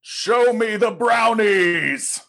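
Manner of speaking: booming demand. Acted. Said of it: "Briv demanding brownies".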